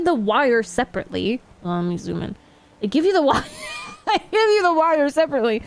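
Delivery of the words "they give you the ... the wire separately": laughing. Real, not acted. Laughing retake.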